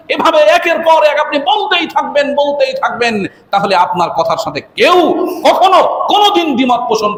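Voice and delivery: high sustained chant-like listing, climbing as it goes; impassioned preaching. Real, not acted. Preacher piling up companions' names as authority, impassioned preaching. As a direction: build name upon name in a ringing voice.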